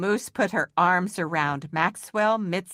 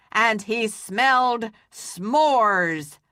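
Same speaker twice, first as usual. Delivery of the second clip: slow, a bit louder storyteller boom; 3 semitones higher, 3 dB louder, slower than usual. Acted. Maxwell telling a scary story, booming voice. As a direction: boom it out slowly to spook them. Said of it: "in a booming voice".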